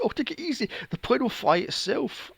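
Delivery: high, breezy mock reassurance; mock casual pilot. Mocking the pilot telling her to relax. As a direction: play him blithely unbothered. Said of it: "And he goes".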